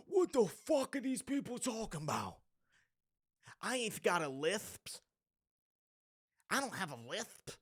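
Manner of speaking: imitating the old streamer. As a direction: mimic the streamer's outraged denial. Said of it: "he's like".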